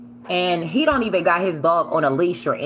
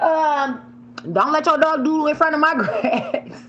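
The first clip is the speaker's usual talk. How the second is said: mock scolding; 11 semitones higher than usual. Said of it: "I'm like".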